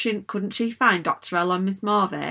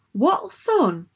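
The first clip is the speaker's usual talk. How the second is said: higher, incredulous question; baffled disbelief; 7 semitones higher than usual. A narrator's reading. Cass shocked at the news.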